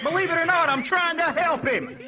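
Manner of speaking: high, loud, laughing defensive reply; exasperated retort. Retold or imitated. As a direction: fire back half-laughing. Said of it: "I'm like".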